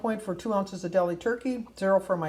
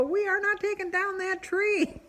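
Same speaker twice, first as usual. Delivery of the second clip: retold insistent no, pitched much higher; exasperated refusal; 12 semitones higher, 3 dB louder than usual. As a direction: put your foot down, high and exasperated. Retold quarrel, third refusal.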